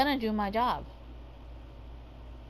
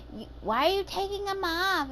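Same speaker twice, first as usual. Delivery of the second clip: mocking the coworker; 8 semitones higher than usual. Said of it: "she's all like".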